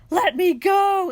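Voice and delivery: loud, high, drawn-out demon wail; imitated demonic screech, stretched out; demon-sounding scream. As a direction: scream it slowly like someone possessed. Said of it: "in this demon-sounding voice".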